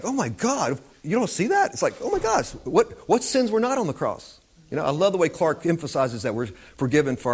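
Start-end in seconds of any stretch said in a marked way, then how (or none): 0.0-4.4 pitched way up, astonished oh my gosh; mock shocked exclamation; incredulous disbelief. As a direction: act amazed that nobody sees it.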